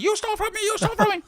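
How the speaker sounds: very high mock yell; hammed-up high-pitched outrage; imitated yelling waiter. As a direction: squawk the accusation like an outraged waiter. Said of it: "He's yelling at me".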